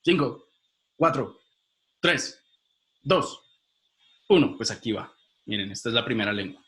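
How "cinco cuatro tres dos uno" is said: countdown. Real, not acted. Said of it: "Voy a revelar la respuesta en cinco segundos".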